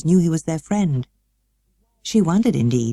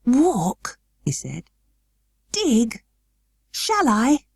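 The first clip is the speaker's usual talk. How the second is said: high, breathless questions; surprised, hardly daring to hope; startled hope; 7 semitones higher, much slower than usual. A narrator's reading. Colin hearing Dickon say he'll walk, startled and hopeful. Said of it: "Colin flushed tremendously".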